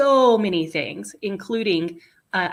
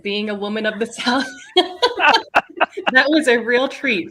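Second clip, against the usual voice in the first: usual speaking voice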